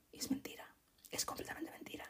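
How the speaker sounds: unvoiced whisper, like telling a secret. Real, not acted. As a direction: lean in and whisper it. Secret confided to viewer. (susurrando como un secreto)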